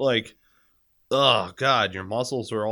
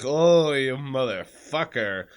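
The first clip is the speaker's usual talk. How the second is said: slow, louder pained curse; 4 semitones higher, 5 dB louder, slower than usual. Retold or imitated. Man voices himself cursing his back, slowly. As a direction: curse at the pain, drawn out.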